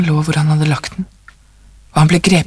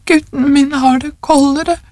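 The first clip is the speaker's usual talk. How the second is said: acted sob, higher voice; plaintive, higher, as if through tears; sobbing old woman; 8 semitones higher than usual. A narrator's reading. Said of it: "sa den gamle konen hulkende".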